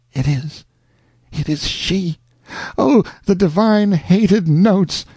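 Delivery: hushed then rising to a high cry; a whisper of joy bursting out; breathless exclamation. A narrator's reading. Alonzo hearing his beloved's song, astonished joy. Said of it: "At last he exclaimed".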